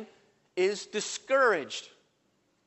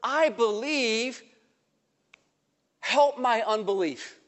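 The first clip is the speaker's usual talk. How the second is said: believer's cry; 5 semitones higher, slower than usual. Acted. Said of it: "the cry of the one with believing doubt".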